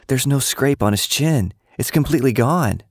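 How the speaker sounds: insistent. A narrator's reading. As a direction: press the point, wide-eyed. Insisting on what she saw.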